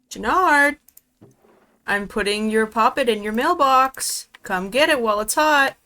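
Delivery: loud call across the room. Real, not acted. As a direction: shout it over so he hears. Calls across to Jannard.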